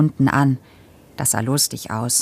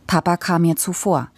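plain reading voice

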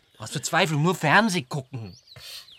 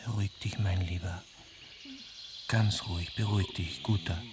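softer, lower soothing whisper; calm him like a scared child; hushed reassurance to a frightened man; 6 semitones lower, 5 dB quieter than usual. Acted. Calming the frightened soldier.

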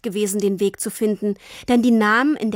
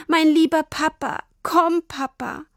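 high, tearful pleading; crying; 7 semitones higher than usual. A narrator's reading. Lost girl crying for her papa, high tearful plea. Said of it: "weinte sie".

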